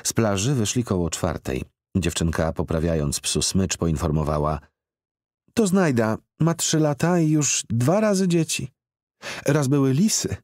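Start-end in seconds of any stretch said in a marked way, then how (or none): 5.5-10.3 put-on high, bright child voice, chattering; little girl voice. A narrator's reading. Girl tells the man about her dog, in a child's voice. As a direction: narrator plays the girl, much higher.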